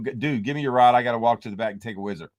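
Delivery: rushed fake excuse to hand off the rod; hurried mock excuse. Fisherman jokes to buddy, rushed fake excuse. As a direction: rattle it off fast, joking. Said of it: "you gotta go".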